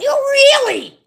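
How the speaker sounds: high, loud incredulous squeak; mock disbelief. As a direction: act stunned in a put-on squeal. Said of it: "And I went".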